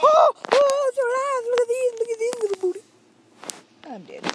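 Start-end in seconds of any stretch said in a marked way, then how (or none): 0.0-2.8 high, loud gleeful squeal; gamer's high excited shriek. Real, not acted. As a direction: squeal with delight. Excited about game level.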